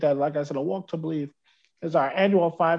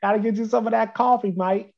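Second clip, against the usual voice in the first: higher-pitched hammed-up Australian accent; 6 semitones higher, 7 dB louder than usual. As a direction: ham up an Aussie bloke offering coffee. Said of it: "you got the accent down pat".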